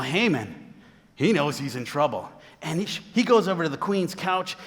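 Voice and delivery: usual speaking voice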